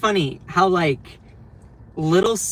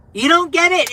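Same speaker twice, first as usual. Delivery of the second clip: "You don't get it" higher and louder; exasperated; 12 semitones higher, 7 dB louder than usual. Real, not acted. Streamer venting at critics, louder and higher. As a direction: burst out in frustration.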